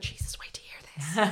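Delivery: hushed gossipy whisper. Retold or imitated. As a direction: lean in and whisper the scandal. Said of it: "line and be like".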